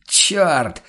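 loud, high angry bark; furious bark at the stuck shutter; barked curse. A narrator's reading. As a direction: snap the curse out hard. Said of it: "рявкнул Ван Ален".

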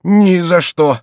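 loud, high flat-out refusal; emphatic cry. A narrator's reading. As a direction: cry out in stubborn protest. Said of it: "вскричал Горанфло".

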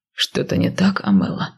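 lightly voiced low male character; inquiring man. A narrator's reading. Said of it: "поинтересовался Гер".

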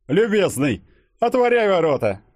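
high haughty call to the guard. A narrator's reading. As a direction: call out the order from afar. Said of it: "крикнул ему молодой господин".

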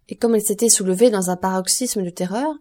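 usual speaking voice

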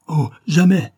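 high, loud passionate outburst; fervent cry of refusal. A narrator's reading. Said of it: "fit-il avec l'accent de la passion".

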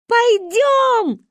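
high excited yell; shouted. A narrator's reading. Kids shout to grandma, high and loud. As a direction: yell it out with glee. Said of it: "заорали мы".

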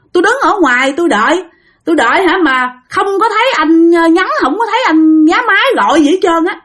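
high, aggrieved complaining friend; a high, whiny voice of the friend left out; indignant protest. Retold or imitated. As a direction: protest that you were left alone outside. Friend protests he never went in.

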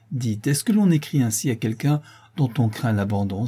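ordinary delivery, nothing marked

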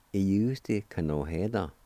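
ordinary delivery

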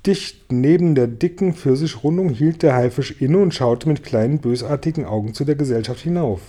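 ordinary delivery, nothing marked

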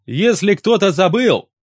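loud, deliberate reminder. A narrator's reading. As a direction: project it to everyone around. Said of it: "я повысил голос".

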